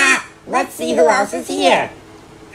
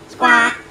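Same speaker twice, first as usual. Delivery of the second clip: parrot squawk. Acted.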